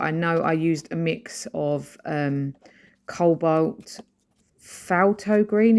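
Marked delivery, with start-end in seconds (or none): 4.6-5.8 "Falto green" said slowly and higher, as a tentative try; unsure pronunciation. Real, not acted. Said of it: "is that how you say it".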